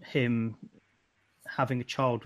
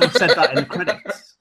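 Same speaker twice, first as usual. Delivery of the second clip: loud, high, laughing delivery; helpless laughter through the words; 5 semitones higher, 9 dB louder than usual. Real, not acted. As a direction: crack up while finishing the joke. Laughing at own joke.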